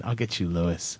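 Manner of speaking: low, hushed pretend threat; mock menace. Host jokingly threatening a rival, low mock menace. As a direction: play a villain vowing revenge under the breath.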